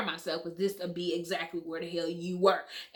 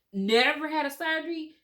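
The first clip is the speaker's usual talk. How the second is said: high, louder astonished question; surprised nurse; 9 semitones higher, 6 dB louder than usual. Retold or imitated. Nurse amazed the mother never had surgery, high and surprised. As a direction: echo it in disbelief.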